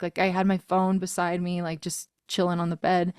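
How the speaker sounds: normal talking voice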